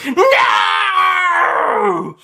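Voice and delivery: high, loud furious shout; angry yell. Acted. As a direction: bellow your grief and rage. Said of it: "The doctor yells angrily".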